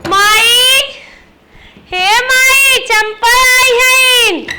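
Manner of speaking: high, drawn-out call to mother; sing-out call across the house. Acted. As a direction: announce your arrival, stretching the last words. Arriving, calls out to mother.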